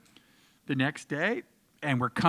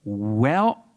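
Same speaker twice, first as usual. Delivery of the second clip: imitating co-star; 5 semitones lower than usual. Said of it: "she goes".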